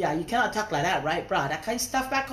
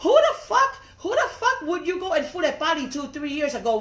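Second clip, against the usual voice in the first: high, loud, laughing outrage; outraged yell; 7 semitones higher, 4 dB louder than usual. Real, not acted. Ranting to viewers about someone keeping an old picture, high incredulous yell. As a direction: yell it in disbelief, half laughing.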